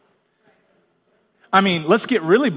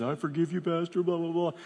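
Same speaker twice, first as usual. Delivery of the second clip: mumbled forgiveness; 10 dB quieter than usual. Retold or imitated. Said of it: "forgives me and says".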